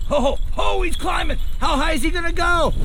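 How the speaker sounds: high, delighted disbelief; amazed cheering. Real, not acted. Watching his dog climb, high amazed cheering.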